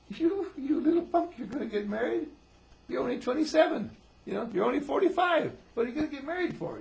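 loud, high, mock-indignant yelling; outraged father. Father hearing of child's marriage plans, loud high outrage. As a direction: shout it in hammed-up disbelief. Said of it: "And he says".

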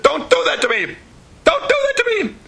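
high-pitched retold cry of protest; upset outburst. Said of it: "why are you so upset?".

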